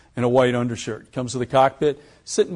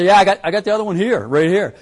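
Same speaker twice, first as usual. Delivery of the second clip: louder, fast, keen confirmation; eager bluff; 7 semitones higher, 7 dB louder than usual. Retold or imitated. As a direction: pilot bluffing the triangle points to the president, loud and eager. Pilot eagerly bluffing triangle points.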